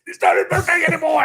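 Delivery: high, loud, rapid protest; mock outrage. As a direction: yell over the host in exasperation. Mock protest interrupting birthday wishes.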